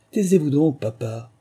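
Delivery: high, loud start dropping off sourly. A narrator's reading. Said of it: "d'un ton aigre".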